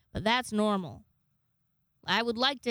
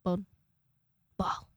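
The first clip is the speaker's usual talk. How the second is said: odd pet brain-noise voice; Martin's brain noise; 3 semitones lower than usual. Acted. Voicing what goes on in pet's brain, odd noise. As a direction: blurt stray words as an animal's brain noise. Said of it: "that's his brain noise".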